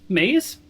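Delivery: "Maze" with a long ay; alternative pronunciation. Shown as a demonstration. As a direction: say "Maze" to rhyme with days, questioning. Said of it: "I can say whatever I want".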